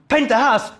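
high-pitched retold sensei shout; shouted command. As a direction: bark out the drill command high. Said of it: "He shouts".

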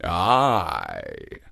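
low fierce lion; fierce growl. A narrator's reading. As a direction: growl assent like a hungry lion. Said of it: "growled the lion fiercely".

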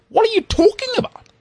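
way up high, mock outrage; disbelief. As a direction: squeal it like you can't believe her. Acting out his disbelief.

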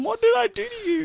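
high, wounded voice asking why; hurt plea. Retold or imitated. To the girl who punched him, a high wounded plea. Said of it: "I was crying from".

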